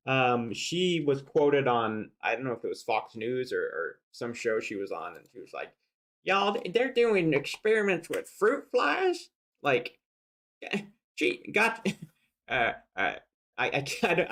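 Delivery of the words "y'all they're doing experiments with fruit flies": much higher, twangy folksy put-on accent; mock Sarah Palin, incredulous and high; Sarah Palin impression. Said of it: "I don't even know what that accent was".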